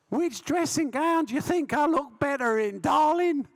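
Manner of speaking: preen in a high squeaky voice; squeaky Joe Bugner. Retold or imitated. Bugner asking his wife about gowns, high squeaky preening.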